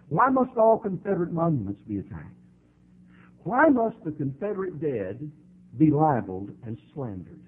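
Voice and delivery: ordinary talk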